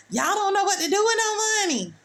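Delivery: high sneering impression of the rich; mocking the rich. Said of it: "They sitting up there telling".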